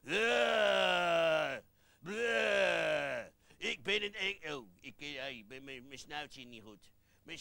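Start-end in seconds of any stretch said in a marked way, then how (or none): 0.0-3.2 long, loud "bleh" roar; drawn-out hammed-up monster bellow; mock monster roar. Monster roars when prompted.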